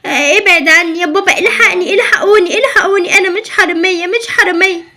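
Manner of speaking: high, loud, panicked crying plea; frantic pleading. Acted. Girl seized by officer, crying for help in panic. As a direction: scream for help as if dragged away by the arm.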